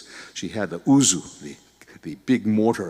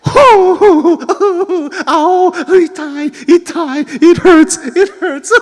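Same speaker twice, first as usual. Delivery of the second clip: ow itai, chillido exagerado entre risas; grito de dolor en broma, muy agudo y fuerte; 12 dB louder, much slower than usual. Acted. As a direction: chilla de dolor como la abuela golpeada. Grandmother hit on the head.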